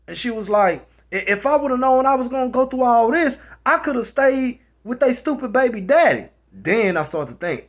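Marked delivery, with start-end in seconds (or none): 1.1-6.3 high, loud, fed-up berating; retold angry rant. Woman regretting her choice to her man, angry rant.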